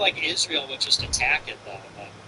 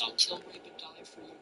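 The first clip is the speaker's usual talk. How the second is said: muttered softly, trailing almost to nothing; quiet mock quote; 12 dB quieter than usual. Streamer quoting what everybody promises, said quietly. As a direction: toss off the cliché under your breath. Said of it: "everybody says".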